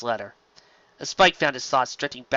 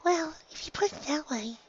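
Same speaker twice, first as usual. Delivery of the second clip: much higher, softer timid voice, slowly; Fluttershy giving in; 8 dB quieter than usual. Acted. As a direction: reluctantly give in. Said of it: "said slowly".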